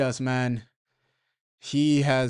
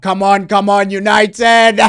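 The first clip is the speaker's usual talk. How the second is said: loud, high shout at the screen; cheering on United; fan shout; 5 semitones higher, 11 dB louder than usual. Real, not acted. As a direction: yell at the team to push on. Fan shouting at live match.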